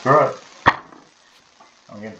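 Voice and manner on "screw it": loud, exasperated give-up; real annoyed burst; fed-up outburst. Real, not acted. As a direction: throw the words away in disgust. Giving up on the game.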